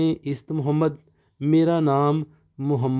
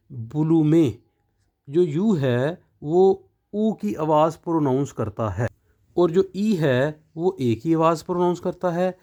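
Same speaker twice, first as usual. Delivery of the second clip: normal talking voice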